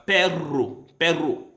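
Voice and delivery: PERRO with a strongly rolled double R; hard Spanish R. Shown as a demonstration. Learner showing hard R in Spanish, rolled. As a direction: trill the middle of perro. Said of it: "The R should be hard like in Spanish".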